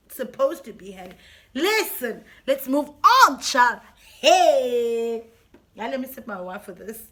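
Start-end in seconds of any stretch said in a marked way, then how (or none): 1.5-5.2 loud sassy brush-off. Real, not acted. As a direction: wave it off with sass.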